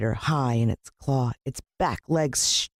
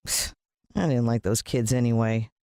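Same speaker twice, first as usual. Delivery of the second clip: ordinary delivery, nothing marked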